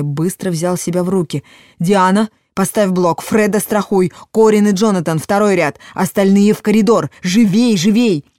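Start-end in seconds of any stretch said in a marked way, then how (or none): 1.8-8.3 loud urgent commands; combat callout. A narrator's reading. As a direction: bark orders fast to the class. Teacher commands class in attack.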